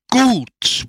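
high, short yelled assent; sharp high shout of approval; crowd's roar. A narrator's reading. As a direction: yell it out like an eager crowd. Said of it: "brüllte das Volk".

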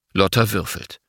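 ordinary delivery, nothing marked

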